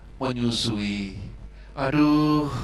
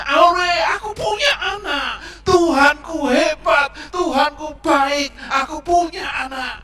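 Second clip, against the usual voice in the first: high, loud joyful exclaiming, slower in the middle; overjoyed mother; 11 semitones higher, 6 dB louder than usual. Acted. Mother thanking God for her baby, loud and high with joy.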